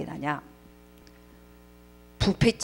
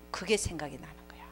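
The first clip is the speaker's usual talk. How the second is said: whisper; 4 semitones lower, 14 dB quieter than usual. Real, not acted. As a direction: drop to a hushed whisper. Dramatic hell recollection. (속삭이는 목소리)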